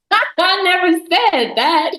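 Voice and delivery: chuckling slow denial; laughing retort. Retold or imitated. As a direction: let the laugh carry the words out slowly. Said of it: "I said".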